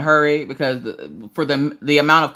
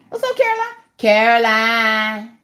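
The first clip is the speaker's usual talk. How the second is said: squeaky high-pitched hello to a viewer; high greeting voice; 8 semitones higher, 3 dB louder than usual. Acted. Streamer greeting a chat viewer, squeaky high voice. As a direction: chirp the viewer's name way up high.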